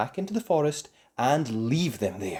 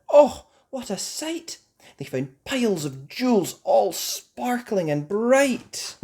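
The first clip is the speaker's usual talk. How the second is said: higher, louder, slow amazed narration; wonder; 10 semitones higher, 7 dB louder, slower than usual. A narrator's reading. Narrating the treasure discovery, high and amazed. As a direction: gasp at the treasure.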